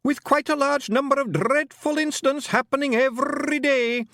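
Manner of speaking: high, loud hammed-up poet declaiming; theatrical groaning recital. A narrator's reading. Pixie poet reciting bad verse at the fairies, hammed-up groan. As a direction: groan the verse like tragic poetry.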